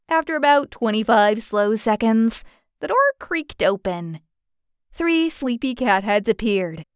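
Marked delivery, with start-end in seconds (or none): none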